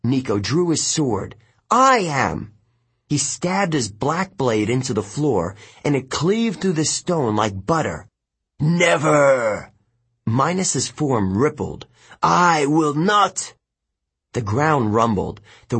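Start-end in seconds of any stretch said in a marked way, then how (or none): 1.7-2.5 higher, forceful claim; defiant assertion. A narrator's reading. Hero claims the ghost's title. 8.6-9.7 ghostly tyrant's drawn refusal; villain's defiant cry. A narrator's reading. Villain refuses while being banished. 12.2-13.5 slow, higher roar of resistance; villain's defiant cry. A narrator's reading. Villain resists being banished.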